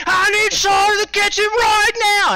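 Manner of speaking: high, drawn-out furious yell; screaming manager. Retold or imitated. Manager summoning the server to the kitchen, imitated screaming. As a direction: bellow it across a noisy kitchen, mimicking an enraged boss. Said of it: "Pazzo is screaming and yelling".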